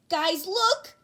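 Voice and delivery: high, loud eager call; excited call. Acted. Fan calling the class to see the video, excited shout. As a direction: grab everyone's attention.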